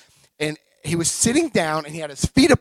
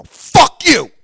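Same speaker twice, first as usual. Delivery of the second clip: loud, high-pitched angry retort; angry outburst; 10 semitones higher, 13 dB louder than usual. Retold or imitated. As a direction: blast it out in sudden fury. Storms up at doctor's insult.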